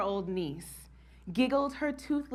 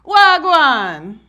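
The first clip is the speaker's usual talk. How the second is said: loud, high Jamaican Patois "Wagwan"; seasoned Patois greeting; 5 semitones higher, 13 dB louder than usual. Retold or imitated. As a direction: belt out "Wagwan" like a true Jamaican. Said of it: "a seasoned and spiced".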